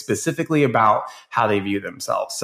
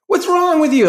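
high, loud mock yell at the teacher; mock kids yelling; 6 dB louder than usual. Kids yelling at their clumsy gamer teacher, mock shriek. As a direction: shriek it like a frustrated kid. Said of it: "kids were like".

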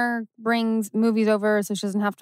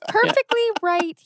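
laughing; 8 semitones higher than usual. Real, not acted. Joking with co-hosts about a prop bench, laughing. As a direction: pitch it up and let the laugh break through.

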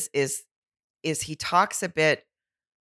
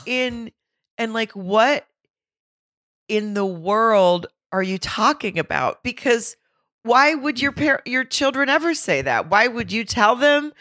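exasperated rant; 6 semitones higher than usual. Real, not acted. Outraged rant about his comment.

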